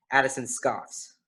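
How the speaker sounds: usual speaking voice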